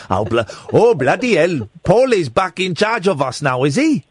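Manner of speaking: hammed-up comic Swiss accent; mock Swiss villager's accent. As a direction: grumble in a put-on Swiss accent. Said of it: "It's a Swiss accent".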